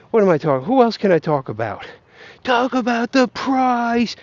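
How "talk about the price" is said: high, drawn-out mimicking demand; mock heckler. Streamer mimicking viewers who want price talk, mock heckler. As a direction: whine it slowly, well above your own voice.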